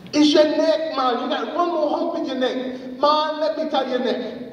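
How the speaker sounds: high-pitched trickster persuasion; urgent coaxing. Acted. Anansi goads the snake further.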